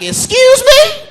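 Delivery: high, slow mock-offended excuse me; indignant surprise. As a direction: act offended at the demand. Dad's indignant reply.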